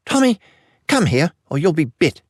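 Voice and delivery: put-on high, sharp call to a child; urgent mother, pitched well up; alarmed mother. A narrator's reading. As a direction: call your son back from danger. Mother calls her son from danger.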